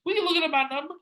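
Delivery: high-pitched indignant quote; exasperated high-voiced employee; heated retold argument. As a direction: push the voice way up high.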